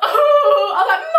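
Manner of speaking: high, whiny cry for help; mock tearful wailing. Calling mum from the bathroom, high mock wail. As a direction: wail like a distraught child, hammed up.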